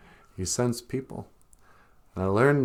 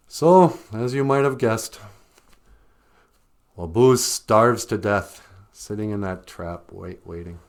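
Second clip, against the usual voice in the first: usual speaking voice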